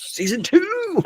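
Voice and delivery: high, giddy little squeal; excited cheer. Real, not acted. Voice actor looking forward to season two, high excited squeal.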